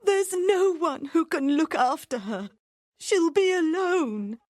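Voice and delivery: very high tearful mother's voice; crying. Acted. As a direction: sob out her worry for her girl.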